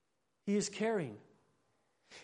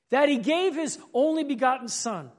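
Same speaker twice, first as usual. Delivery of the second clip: usual speaking voice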